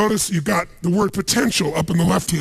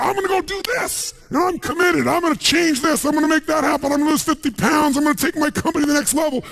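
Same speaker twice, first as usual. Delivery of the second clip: all excited; 11 semitones higher than usual. Retold or imitated. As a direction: pump yourself up like a new convert. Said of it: "and you're all excited".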